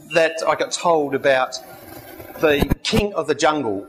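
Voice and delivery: ordinary delivery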